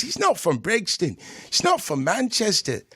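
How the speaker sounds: London street accent, put on; mock British accent. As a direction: talk like a British road man. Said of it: "I don't know if I can stop talking like this".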